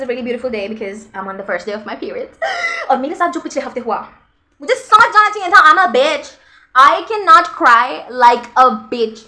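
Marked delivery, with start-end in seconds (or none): 4.6-9.2 higher, louder, fast rant; worked-up rant. Real, not acted. Venting to viewers about last week, worked up. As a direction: vent it loudly at the camera.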